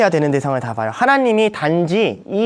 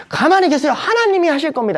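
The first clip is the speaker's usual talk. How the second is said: much higher, hammed-up assured proclamation; Moses, lofty confidence; 10 semitones higher than usual. Moses reassuring the grumblers, hammed-up confident. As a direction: play it as smug certainty. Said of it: "모세가 배운 하나님이에요".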